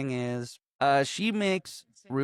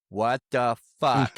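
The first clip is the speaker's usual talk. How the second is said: slow, dragged-out stunned what the fuck; stunned disbelief; 4 dB louder, slower than usual. Retold or imitated. Bartleby hears the plan across the table, slow stunned disbelief. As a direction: let it sink in, aghast.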